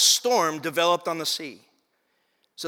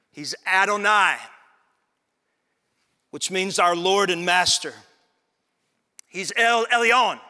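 slow, loud, proclaiming litany; preaching crescendo; 5 semitones higher, 6 dB louder, slower than usual. Acted. Pastor proclaiming God's names to his church, slow and loud. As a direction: proclaim each name like a declaration.

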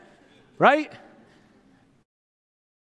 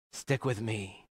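hushed low; 10 semitones lower, 15 dB quieter, slower than usual. Acted. Repeated, even softer.

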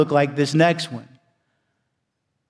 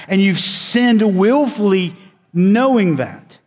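fiery preaching; 4 semitones higher than usual. Acted. Preacher warning the congregation they fall short, forceful preaching. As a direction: drive it home to the congregation.

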